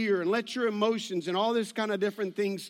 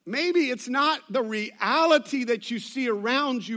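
ordinary talk, nothing marked

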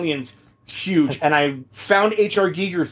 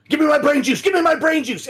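manic demand; 9 semitones higher than usual. Retold or imitated. Gamer stomping enemies, crazed cry for brain juice. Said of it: "went from scary for me to".

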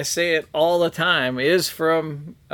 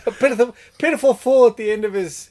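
laughing quote; 6 semitones higher than usual. Retold or imitated. Quoting Liar Liar scene.

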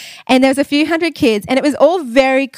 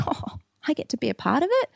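whispered, pitched higher with excitement; excited whisper; 5 semitones higher, 8 dB quieter than usual. Retold or imitated. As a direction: whisper like a thrilled ten-year-old. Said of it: "And I was like".

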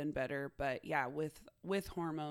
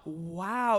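put-on toddler wow; 8 dB louder than usual.

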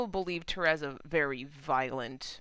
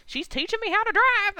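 much higher and louder mock whoop; imitating Millie whooping while driving badly, high and loud; giddy joyride; 8 dB louder than usual. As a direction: whoop it like a reckless driver having fun. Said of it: "driving like a maniac ... she's like".

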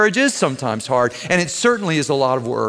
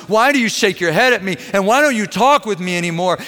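mild mock shout, higher pitch; raised-pitch retelling of shouted complaints; retold shouting; 6 semitones higher than usual. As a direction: imitate an upset outburst without fully shouting. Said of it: "begins to shout and says".

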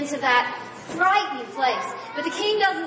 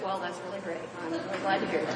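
much softer, lower, bored politeness; underplay it, unimpressed; mock dull reaction; 8 semitones lower, 8 dB quieter than usual. A king mock-calmly receiving great news, flat and quiet. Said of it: "he wasn't just like".

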